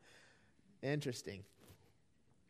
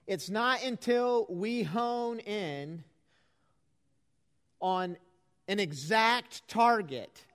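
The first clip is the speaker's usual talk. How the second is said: building sermon; 4 semitones higher than usual. Acted. Preaching builds.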